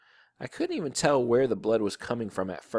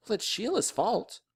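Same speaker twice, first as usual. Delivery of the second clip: high, whiny self-defence; protesting boy; 10 semitones higher than usual. A narrator's reading. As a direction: shift the blame in a higher kid voice. Boy argues back to mother.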